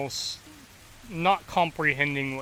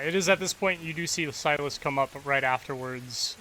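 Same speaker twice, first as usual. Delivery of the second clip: ordinary delivery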